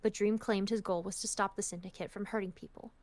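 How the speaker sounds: calm, even storytelling read; story narration. Acted. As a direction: read the chapter like an audiobook. Reading a fanfic aloud.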